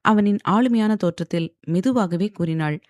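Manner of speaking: ordinary delivery, nothing marked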